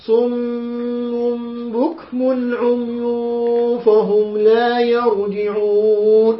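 صم بكم عمي very slow with held ghunna; slow recitation showing iqlab; tajweed demo. Shown as a demonstration. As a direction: draw out the meem of صم and بكم. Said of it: "انتبهوا لأحكام".

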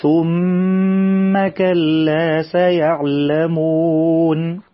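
very slow chanted recitation, doubled meem held; Quran recitation. Shown as a demonstration. Teacher models the verse for children, slow chanted recitation. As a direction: chant it slowly as in tajweed class.

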